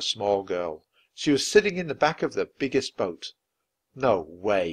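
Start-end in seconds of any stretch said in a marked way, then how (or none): none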